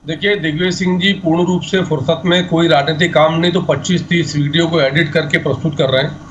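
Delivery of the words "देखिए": usual speaking voice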